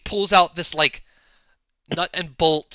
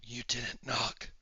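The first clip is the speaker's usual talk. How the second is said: very soft, slow, low reproach; hushed recluse; 5 semitones lower, 14 dB quieter, slower than usual. Acted. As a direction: murmur it slowly, barely audible. Unsettled recluse answers his visitor.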